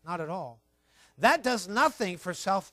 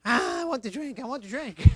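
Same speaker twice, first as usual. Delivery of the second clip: put-on whiny child; 4 semitones higher than usual. Preacher voicing a wanting child.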